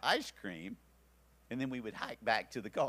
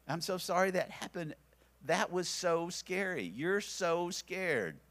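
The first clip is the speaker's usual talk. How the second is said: put-on comforting a scared child; 6 semitones higher than usual. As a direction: soothe him, dwell on each word. Father comforting scared son.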